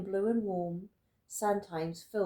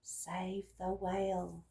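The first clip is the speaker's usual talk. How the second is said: drawn-out, softer message; slow, soft reading; 2 semitones lower, 6 dB quieter, slower than usual. Acted. Narrator reading the snail's trail message slowly. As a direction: spell out the trail's words slowly. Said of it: "a silvery trail saying".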